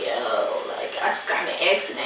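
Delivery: whispered, fast phone confession; sneaking a call to the supervisor, whispered; hushed. Retold or imitated. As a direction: breathe it low so nobody hears.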